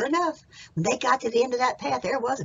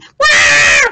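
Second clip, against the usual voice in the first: high, long, loud yell; piercing howl of alarm; robber's scream; 20 dB louder than usual. Acted. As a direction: holler out as the bitten robber. Acts out the bitten robber screaming.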